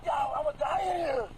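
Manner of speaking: slow, desperate cry out; yelling in real panic; panicked shout. Real, not acted. Panicked refusal to enter car.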